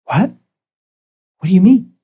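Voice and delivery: puzzled retold protest; confused surprise. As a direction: caught off guard, asking back. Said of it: "And I went".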